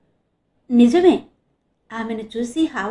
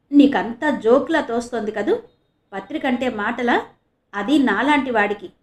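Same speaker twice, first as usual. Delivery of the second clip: plain reading voice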